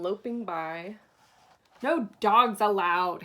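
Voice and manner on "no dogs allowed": loud, slow angry warning; grumpy cactus yelling at a coyote; yelled. Acted. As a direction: yell it and drag each word. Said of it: "Hank yelled".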